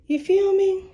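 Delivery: high, loud playful check-in; a loud, high hype question to the audience; hyped question. Real, not acted. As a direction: punch it up to the viewers. Hyping turmeric to viewers.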